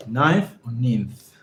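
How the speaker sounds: first "Ninth" rhymes with nine, second "ninth" as ninnth; comparing two pronunciations. Shown as a demonstration. Learner unsure of the word, "Ninth" as nighnth or "ninth" as ninnth. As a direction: slowly offer "Ninth" with long i, then "ninth" with short i. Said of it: "Let me check that for you".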